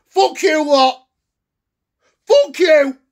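normal talking voice

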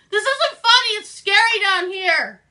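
loud frightened plea. Acted. Calling to Josh in the basement, loud scared plea. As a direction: plead into the dark, scared.

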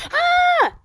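very high scared ah; startled shriek. Real, not acted. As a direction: shriek in a sudden fright.

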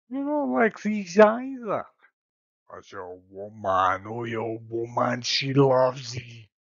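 a seductive Spanish lover; Spanish accent. Acted. Paris in stage Spanish.

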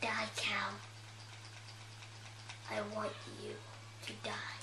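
mock-threatening whisper to a cow; menacing whisper. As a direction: hiss it quietly like a tiny villain. Kid whispering at Minecraft cow.

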